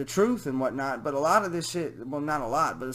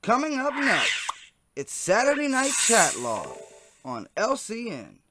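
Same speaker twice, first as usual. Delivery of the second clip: loud TV promo voice; announcer; 7 semitones higher, 7 dB louder, slower than usual. Acted. Announcing the fake channel's lineup, loud promo read. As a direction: boom it like a network promo.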